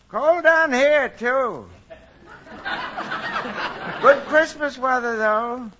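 cheery call over a long line; long distance phone call. Acted. Santa phones Mom at North Pole.

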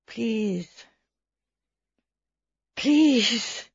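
faint, feeble plea; a dying man's weak begging; dying man pleading weakly with Bunch. A narrator's reading. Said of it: "the man said in a weak voice".

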